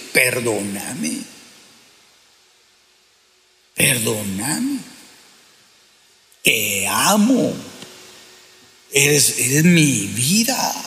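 tender, slow husband's apology to his wife. Shown as a demonstration. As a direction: ask forgiveness gently, barely above a murmur. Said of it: "y decir".

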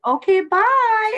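high, drawn-out mock-sweet goodbye; sing-song brush-off; dismissive sign-off. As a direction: stretch it out high to brush him off. Retelling her dismissal of the man.

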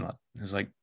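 ordinary talk

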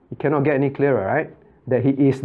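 ordinary talk, nothing marked

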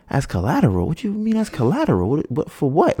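high-pitched, baffled questioning; incredulous echo. Retold or imitated. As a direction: throw it back in acted disbelief, pitch leaping up. Echoes the answer in disbelief.

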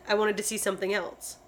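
ordinary talk, nothing marked

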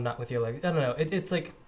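usual speaking voice

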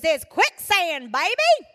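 imitating a friend's Kentucky accent, hammed up. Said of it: "You talk about an accent".

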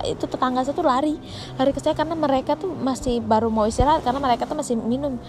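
ordinary delivery

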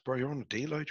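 ordinary talk

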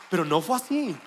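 ordinary talk, nothing marked

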